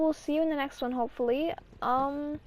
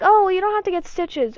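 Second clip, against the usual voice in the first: higher, louder quoted reassurance; imitated doctor; 6 semitones higher, 7 dB louder than usual. Doctor telling a hurt child the good news, high imitated voice. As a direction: put on the doctor giving good news, up and loud.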